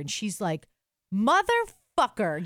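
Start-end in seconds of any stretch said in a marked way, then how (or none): 1.1-2.4 slow, loud, drawn-out swear; furious curse. Retold or imitated. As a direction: spit it out in slow disbelief. Mom discovers the scam messages.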